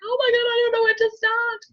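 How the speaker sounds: high, despairing cry of overwhelm; overwhelmed wail. Retold or imitated. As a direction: wail it like you're lost. Self overwhelmed at desk.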